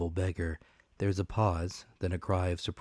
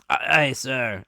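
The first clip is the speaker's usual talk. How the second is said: pirate answer; 6 semitones higher, 8 dB louder, slower than usual. Acted. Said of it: "answered two or three".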